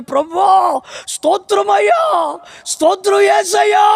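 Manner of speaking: slow, high, pleading praise with a different voice; fervent prayer. Acted. A kneeling woman cries out her praise, slow and fervent. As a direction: pour it out on her knees.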